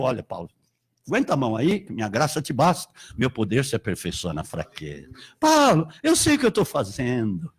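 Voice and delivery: voice of God. Retold or imitated. God answering Paul's complaint, low and calm. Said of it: "Aí Deus respondeu".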